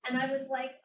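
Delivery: ordinary talk, nothing marked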